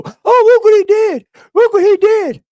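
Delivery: very high, loud mimicking squeal; mocking whiny critic. Streamer mimicking critics who complain once he hits back, mocking squeal.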